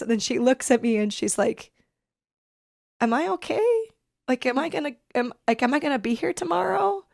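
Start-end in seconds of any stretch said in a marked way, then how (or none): 3.0-7.0 high, tear-choked quoting of mom; tearful quote. Real, not acted. Daughter quoting mom's frightened question, tearful. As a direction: let real tears lift the voice. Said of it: "I'm welling up with tears now like this".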